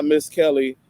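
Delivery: ordinary talk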